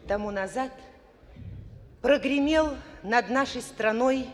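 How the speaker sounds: usual speaking voice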